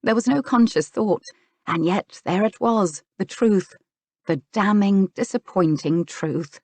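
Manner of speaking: ordinary delivery, nothing marked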